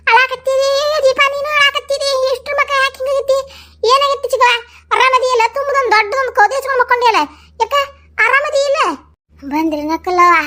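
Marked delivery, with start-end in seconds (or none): 0.1-8.8 higher, worried questions to a crying friend; alarmed aunt-like concern. Acted. (ಕಳವಳದಿಂದ ಕೇಳುವುದು)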